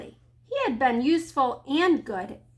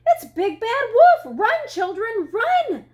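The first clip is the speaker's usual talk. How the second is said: high-pitched alarmed hen squawk; 9 semitones higher, 7 dB louder than usual. A narrator's reading. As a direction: squawk a high warning to your chicks. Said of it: "squawked Missus Chicken".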